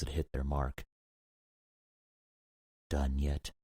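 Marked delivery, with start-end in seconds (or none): 2.9-3.5 chilly. A narrator's reading. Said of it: "The chill in her voice".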